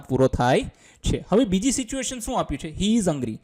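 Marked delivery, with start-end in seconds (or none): none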